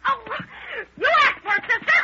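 a shrieking threat at the start of a fistfight; angry shout. Acted. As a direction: scream it as you lunge. Catfight between two women.

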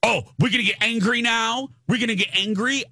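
high-pitched goading questions, rising at the end; mocking taunt. Mimics the mother's taunt again.

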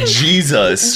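imitating an odd, drawn-out "Jesus"; mimicked pronunciation of Jesus. Teasing a friend over how they said it, mimicked "Jesus". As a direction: stretch "Jesus" slowly, copying how it came out. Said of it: "you said it like".

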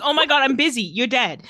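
hammed-up exasperation, starting high and loud; mock-harried impatience; sick of it. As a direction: brush them off loudly, as if far too busy. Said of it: "you're just sick of it".

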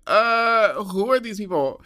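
loud, high-pitched mock incredulity; drawn-out uh, then a loud incredulous question; retold disbelief. Roasting a friend who claims fame, loud mock disbelief.

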